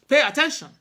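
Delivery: stern command. Retold or imitated. Mother ordering her daughter to listen, sharp and loud. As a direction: bark it like a mother who means it. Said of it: "I know that tone well".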